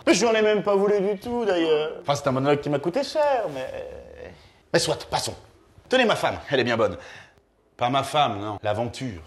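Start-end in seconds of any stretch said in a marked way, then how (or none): none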